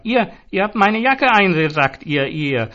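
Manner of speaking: high, loud sputtering accusation; furious stammered accusation; stammering rage. A narrator's reading. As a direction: sputter with fury. Zoran raging, stammering accusations.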